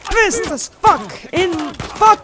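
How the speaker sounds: high, loud furious outburst; pissed off. Real, not acted. Player fed up with the game, furious shouting. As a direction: yell it in real frustration. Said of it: "I'm fucking pissed up".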